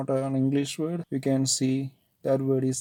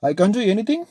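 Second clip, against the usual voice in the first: much higher, loud, breathless fury; panting anger; 8 semitones higher, 6 dB louder, much slower than usual. Acted. Nomita breathless with anger at Ajit, high and loud. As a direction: choke out the words in rage. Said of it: "Almost panting".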